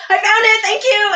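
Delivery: high, loud called-out thanks; giddy call while running; excited call. Real, not acted. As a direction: shout it over your shoulder while running. Running past the guy.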